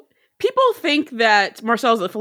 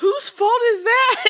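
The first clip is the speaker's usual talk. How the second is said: high, laughing accusation; 10 semitones higher than usual. Real, not acted. Laughing as she blames her co-host. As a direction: blurt it out through real laughter.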